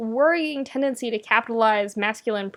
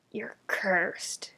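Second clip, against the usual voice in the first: breathy hushed curse; whisper; 2 semitones lower, 9 dB quieter than usual. Acted. Keith muttering a curse at the stuffed bear in a whisper.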